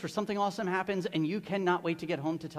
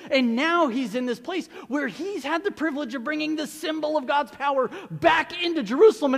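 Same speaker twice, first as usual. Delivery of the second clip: ordinary delivery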